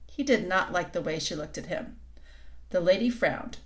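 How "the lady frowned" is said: ordinary delivery, nothing marked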